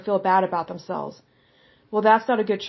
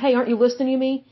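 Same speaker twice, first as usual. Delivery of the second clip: fast, higher mock complaint; rude impatient demand; 4 semitones higher, much faster than usual. Showing disrespect toward God: fast, impatient demand. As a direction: snap it like an annoyed nag. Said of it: "that's very disrespectful to say".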